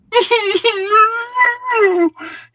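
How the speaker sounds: high wailing sob; terrified sobbing; crying whimper. Acted. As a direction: cry out in terror. Tommy sobs under questioning.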